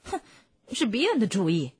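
put-on voice, grudging agreement; chiming in. A narrator's reading. Bunce blaming Bean for the idea, chiming in. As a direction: chime in with a huff of blame. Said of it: "邦斯附和道".

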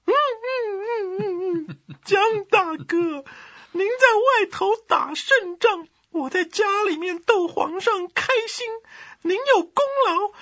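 very high, loud mock sobbing eunuch; hammed-up wailing. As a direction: wail it out like a fake-crying courtier, building to a shout. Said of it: "皇后哭起来可更大声了". (夸张地假哭哀嚎)

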